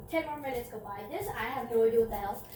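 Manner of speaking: usual speaking voice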